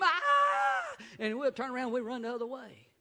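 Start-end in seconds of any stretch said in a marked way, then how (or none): 0.0-1.0 high, loud mock child scream; playful high-pitched yelp; child's scream. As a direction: squeal it like a startled little boy. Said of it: "and he'll scream".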